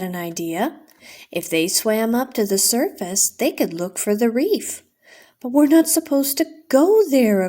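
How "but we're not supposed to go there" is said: higher, louder alarmed warning. Acted. Aquata warning her sisters about the surface, raised alarmed voice. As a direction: stop your sister before she goes. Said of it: "Aquata warned".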